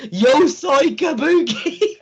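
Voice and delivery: mock proud declaration.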